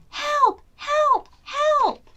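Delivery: high, loud panicked cries; cry for help. Acted. Granny trapped in the pot, screaming for help. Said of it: "Granny cried".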